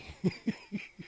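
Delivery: high, breathy run of chuckles; real chuckling. Real, not acted. Joke teller amused by himself, chuckling. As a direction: giggle on after your own joke.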